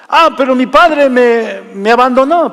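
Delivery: high, put-on complaint; mock objecting congregant. Preacher mimics congregant blaming absent father, mock complaint. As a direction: protest like a resentful churchgoer making excuses.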